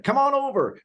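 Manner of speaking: high-pitched carnival barker's call. Retold or imitated. Co-host imitating a ride attendant inviting guests in, mock carnival barker. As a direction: call it out like a barker drumming up riders. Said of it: "he was like a carnival barker".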